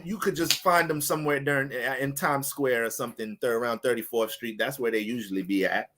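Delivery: lightly voiced low old grandma; a hint of a grumbling granny. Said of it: "I got my grandma voice on".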